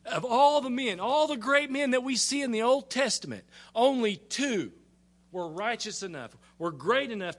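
high, loud preaching wonder; amazed. Real, not acted. Preacher telling the congregation how rare this is, loud amazement. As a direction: marvel loudly, riding high on each phrase. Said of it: "Isn't that amazing?".

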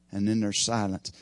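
normal talking voice

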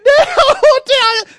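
high, hammed-up childish bawling; mock crying boy. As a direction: bawl crocodile tears like a kid. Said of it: "Crying. Tears. Crocodile tears".

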